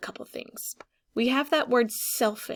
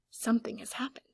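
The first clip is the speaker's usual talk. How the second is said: whisper; 5 dB quieter, faster than usual. Acted. Gasp, dramatic discovery.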